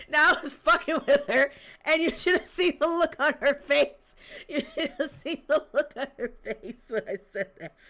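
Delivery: words squeezed out through loud, high laughter; laughing hard. Real, not acted. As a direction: talk while cracking up. Laughing recalling mom's face.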